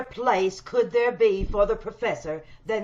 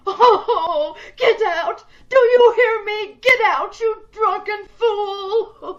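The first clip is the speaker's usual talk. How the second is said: higher and louder, breaking into tears; furious tearful scream; 9 semitones higher, 6 dB louder, slower than usual. Acted. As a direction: throw the drunk out in a rage. Patient screams at doctor.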